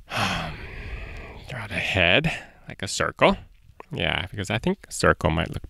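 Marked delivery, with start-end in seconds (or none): none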